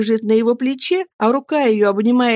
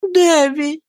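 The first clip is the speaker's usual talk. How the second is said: pleading mother; 9 semitones higher, 3 dB louder than usual. A narrator's reading. Mother calls again.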